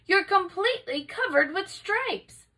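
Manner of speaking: mother stunned by her daughter's stripes; alarmed cry. A narrator's reading. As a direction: let the shock lift the voice high. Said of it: "she cried".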